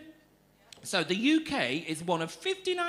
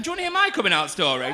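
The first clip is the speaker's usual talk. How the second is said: louder, lifted call to the audience; rousing the crowd; 3 semitones higher, 7 dB louder, faster than usual. Real, not acted. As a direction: ask it loud, fishing for a cheer. Re-asks after a weak audience response.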